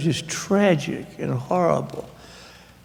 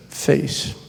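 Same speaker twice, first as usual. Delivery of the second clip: ordinary delivery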